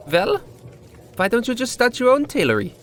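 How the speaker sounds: a high-voiced bartender tempting the down-on-his-luck tailor; sly bartender. A narrator's reading. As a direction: dangle the idea like bait.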